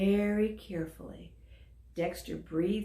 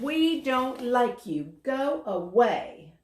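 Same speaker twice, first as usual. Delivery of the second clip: loud, slow, higher jeer; shouted; 6 semitones higher, 5 dB louder, slower than usual. A narrator's reading. Zebra shouting at the lonely dragon to leave. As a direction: shout them away, slowly. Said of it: "The zebra shouted".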